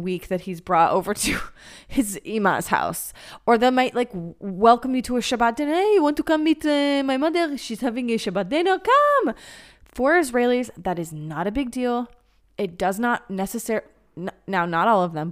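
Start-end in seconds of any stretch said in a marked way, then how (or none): 5.7-9.3 higher, louder breezy Israeli invite; Israeli guy. Retold or imitated. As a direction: wave her in like it's no big deal. Imitating Israeli guy's invitation.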